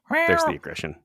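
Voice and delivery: squeaky high mock voice. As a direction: squeak it out playfully. Mock squeal after cat hiss.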